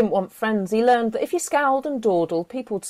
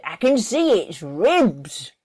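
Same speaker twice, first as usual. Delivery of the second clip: slow grumbling complaint; grumbling Joy; 4 dB louder, slower than usual. Acted. Joy grumbling to Dillard about the cow's ribs. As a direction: complain slowly and sourly. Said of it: "Joy grumbled".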